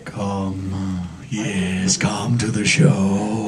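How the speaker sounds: creepy barker. Acted. Creepy van barker bit.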